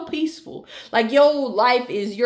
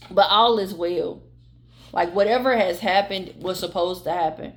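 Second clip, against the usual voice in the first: calm tender closing blessing, softer; soothing reassurance; 5 semitones lower, 4 dB quieter than usual. Acted. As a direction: ease them into peace, gently and softly. Closing reassuring message to viewer.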